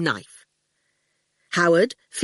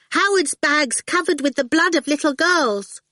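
put-on little girl voice; 9 semitones higher than usual. A narrator's reading. Awful taunting the goon.

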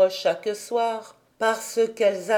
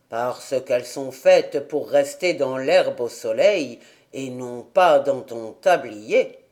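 put-on lower, gruff old man; old grandfather; 8 semitones lower than usual. A narrator's reading. Grandfather answers Heidi about the flowers, lower old man's voice. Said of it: "répondit le grand-père".

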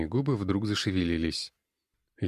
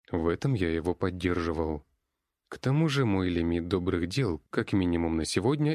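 ordinary delivery, nothing marked

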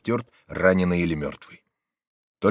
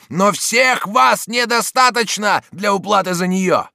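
high, slow, loud cry; defiant shout; 12 semitones higher, 7 dB louder than usual. A narrator's reading. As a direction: shout it across a fight. Shouted dialogue in a fight. (громкий вызывающий крик)

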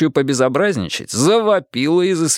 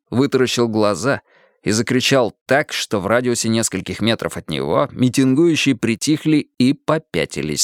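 ordinary delivery, nothing marked

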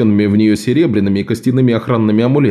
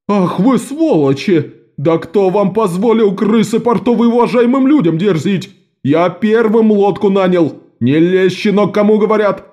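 yelling merchant; 8 semitones higher than usual. A narrator's reading. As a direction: spit the insults at them. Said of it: "завопил торговец".

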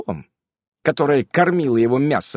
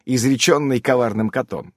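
usual speaking voice

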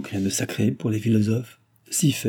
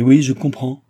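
louder, higher exasperated retort; 4 semitones higher, 7 dB louder than usual. A narrator's reading. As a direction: fling it back at him, louder. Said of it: "s'écria Nicole exaspérée".

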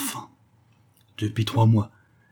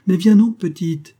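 loud, much higher call across the distance; mother calling her daughter loudly; 9 semitones higher, 8 dB louder than usual. A narrator's reading. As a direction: shout to the girl to come along.

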